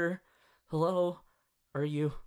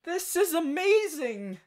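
high, slow delighted exclaim; amazed; 10 semitones higher, 4 dB louder than usual. Acted. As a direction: savour the wonder. Marvelling at animal form.

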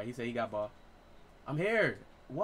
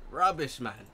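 put-on British accent; slower than usual. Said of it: "You gotta use that accent".

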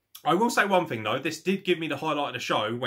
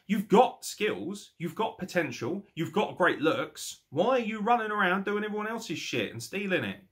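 ordinary talk

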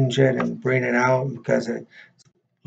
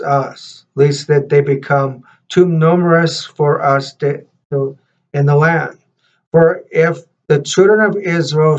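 louder, measured read-aloud voice; scripture reading; 3 semitones higher, 4 dB louder than usual. Real, not acted.

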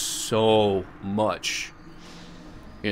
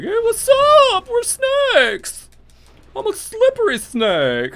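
very high, squeaky cartoon snake voice; silly snake voice; 6 dB louder, slower than usual. Streamer imitating snakes greeting him, high silly snake voice. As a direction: play a goofy snake greeting you. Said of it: "they're gonna be like".

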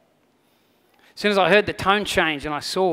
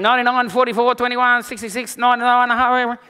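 put-on kids counting; 6 semitones higher, 7 dB louder than usual. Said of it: "So they start counting".

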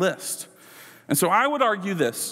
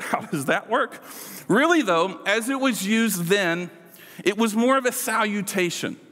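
usual speaking voice

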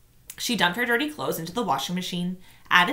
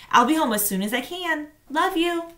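lightly raised loving mother voice; a hint of a mom saying goodbye; lightly voiced mother; mother signing off; 9 semitones higher, 4 dB louder than usual. A narrator's reading.

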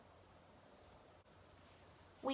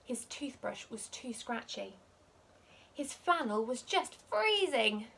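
plain reading voice

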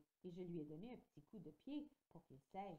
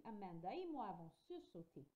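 ordinary delivery, nothing marked

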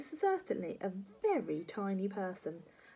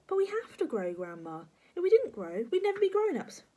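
put-on high, raised child voice; earnest, pitched-up boyish protest; protesting small boy; 11 semitones higher, 6 dB louder than usual. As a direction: argue back as a little boy. George protesting to grandma.

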